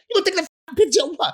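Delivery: light impression of a high-pitched tough guy; imitating Mike. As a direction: squeak it out like a high-voiced boxer. Mike's demand retold again.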